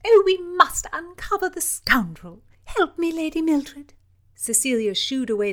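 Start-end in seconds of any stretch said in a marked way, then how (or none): 0.0-3.9 high, loud eager call; rally her lady to help; eager princess. Acted.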